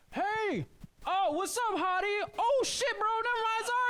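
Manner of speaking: very high-pitched drive-by catcall; passing-car heckle. Acted. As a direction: call out like a heckler whizzing past. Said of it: "this really fun Doppler effect".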